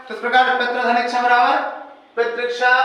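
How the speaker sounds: ordinary talk, nothing marked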